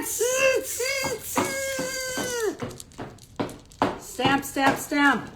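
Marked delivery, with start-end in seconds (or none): 0.0-2.9 monkey tss. Acted. Monkeys mocking the peddler back, high little tss sounds. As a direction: tut back like cheeky monkeys. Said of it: "stamped both their feet back and said".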